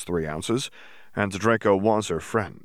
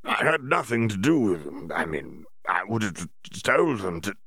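mild stammer. A narrator's reading. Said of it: "Bagman stammered".

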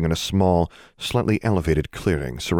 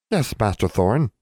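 house elf voice; 4 semitones higher than usual. A narrator's reading. House elf answers master.

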